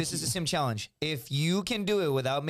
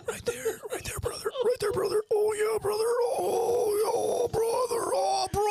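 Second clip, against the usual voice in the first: hype shout; slower than usual. Real, not acted. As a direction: scream him on like a wrestling promo. Cheering friend, wrestler-style hype.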